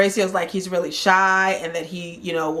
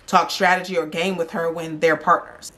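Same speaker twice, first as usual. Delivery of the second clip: ordinary talk, nothing marked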